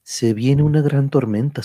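ordinary delivery

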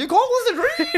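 very high laughing greaser voice; hammed-up tough guy, cracking up. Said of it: "he's putting on a voice".

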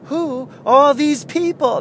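high, drawn-out stand-up comic impression; Jerry Seinfeld. Showing how the comedian opens a bit, bad impression. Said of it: "That's a bad Seinfeld impersonation".

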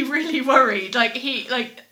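ordinary talk

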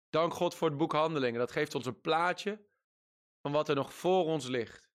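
ordinary talk, nothing marked

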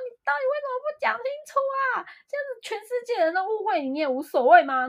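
high, fast, near-shouting plea; retold hysterical plea to a friend, high and fast; mock hysterical outburst. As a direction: reenact a hysterical demand for an explanation. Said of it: "应该是说就是有点歇斯底里".

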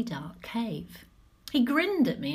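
ordinary delivery, nothing marked

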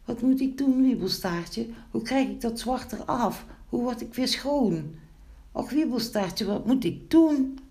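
high, plaintive wailing plea; distressed. A narrator's reading. Sooty gnome begs Wiebelstaartje for help, distressed. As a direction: lament helplessly, pitched right up. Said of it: "Wat keek die arme Pinkeltje verdrietig".

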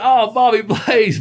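high, loud, drawn-out greeting; imitating a delighted, slow exclamation; excited recognition. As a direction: hail a pal you just recognized. Retold friend suddenly recognizing him.

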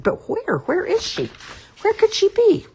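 very high, rising little chick voice; a lost chick growing frantic; anxious baby bird. Acted.

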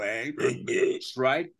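usual speaking voice

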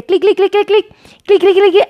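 quick, high-pitched run of clicks; imitated clicking. Host imitates viewers' racing fingers, playful clicking. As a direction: rattle off playful rapid-fire click noises.

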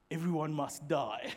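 low, softly ominous film line; mock menacing. Preacher quoting his kind of movie, mock menacing. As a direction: play the grim movie avenger.

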